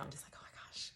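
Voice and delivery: hushed, emotion catching in the throat; choked-up whisper. Real, not acted. Confiding how anxious it made her, a choked whisper. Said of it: "you can hear it in my throat now".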